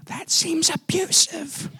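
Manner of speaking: high, soft, slow mock gasp; shocked onlookers. Onlookers aghast at his parenting, high soft mock. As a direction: play the appalled stranger, soft and high. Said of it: "they were looking at me like".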